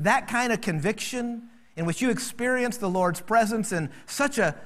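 usual speaking voice

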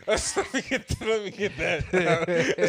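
talking through high laughter; laughing hard. Real, not acted. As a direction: squeeze the words out while cracking up. Cracking up over daughter's fright.

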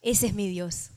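hushed, contented marvelling; awed relief. Acted. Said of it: "y uno se siente como".

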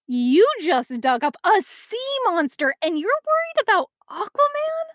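indignant question that peaks squeakily high at the end; incredulous. A narrator's reading. Wally challenging Calder over the sea monster, incredulous. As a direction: pitch climbing high in disbelief.

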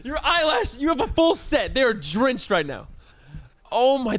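normal talking voice

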